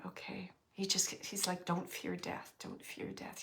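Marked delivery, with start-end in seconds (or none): 1.7-3.4 soft unvoiced whisper of the spirit's words; hushed, soft repeated warning; whispered message. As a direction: breathe it out gently like a message from beyond. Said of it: "He's just saying it like that".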